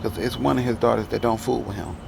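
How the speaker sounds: ordinary delivery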